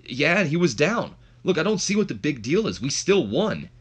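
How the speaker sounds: high, animated retort in an argument; defensive protest. Retold or imitated. Test arguing with Trish over the match, defensive.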